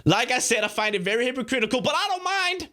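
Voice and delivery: quickening mock quote that climbs in pitch; mocking imitation. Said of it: "he goes like this".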